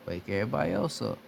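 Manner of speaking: ordinary talk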